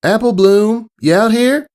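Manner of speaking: high, loud country call; older sister calling for Apple Bloom. A narrator's reading. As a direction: shout for her little sister from afar. Applejack calls out from off.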